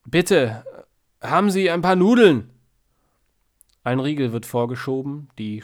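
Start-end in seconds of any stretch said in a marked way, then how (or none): 0.1-2.5 loud, higher begging; pleading loudly. A narrator's reading. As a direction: beg loudly for food. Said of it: "blärt er".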